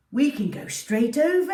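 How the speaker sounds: a dad bursting with good news; excited dad. Acted. Dad telling the family after the call, excited.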